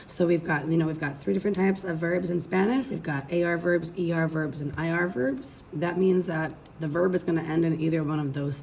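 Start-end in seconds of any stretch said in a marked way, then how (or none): none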